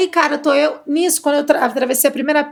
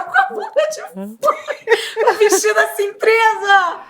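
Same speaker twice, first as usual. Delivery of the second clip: laughing; 9 semitones higher, slower than usual. Retold or imitated. Retelling embarrassing stuck dress.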